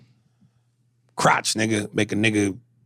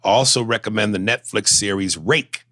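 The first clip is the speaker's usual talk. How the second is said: ordinary talk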